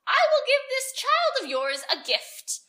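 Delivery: put-on crackle it out like a furious old witch; wicked fairy refusing to stay, crackling. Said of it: "she crackled".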